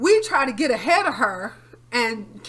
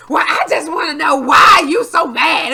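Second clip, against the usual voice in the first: mimicking mom; 4 semitones higher, 12 dB louder than usual. Mother's voice in argument.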